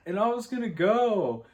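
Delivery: high, nervous, slow boyfriend imitation; high mock boyfriend voice. Boyfriend nervously telling Nikki about boys' night, high mock voice. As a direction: pitch way up and tiptoe around her. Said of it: "He's like".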